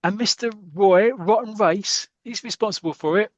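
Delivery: high, loud acted rant; hammed-up angry footballer; indignant fury. Dropped player fumes at his manager.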